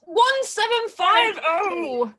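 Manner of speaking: high, slow, drawn-out price call; excited slow announcement. Real, not acted. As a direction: draw out the final price with excitement. Auction final price announced.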